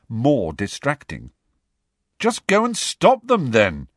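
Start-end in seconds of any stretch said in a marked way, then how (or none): 2.2-3.9 loud, high, fed-up retort; irritable. A narrator's reading. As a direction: snap at her in irritation. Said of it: "he said irritably".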